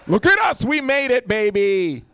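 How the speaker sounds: high, loud, fast cheer; mock celebration. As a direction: whoop it up with a fellow Colombian. Celebrates with a fellow Colombian.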